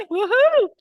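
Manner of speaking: high, loud whoop; joyful woohoo; excited cheer. Real, not acted. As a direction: cheer the room on with a big whoop. Cheering at the meeting opening.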